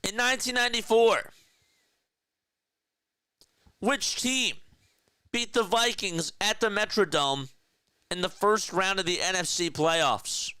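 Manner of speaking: high, bright game-show question voice; quiz host read. Acted. Host quizzing Larry and chat with a trivia question, game-show voice.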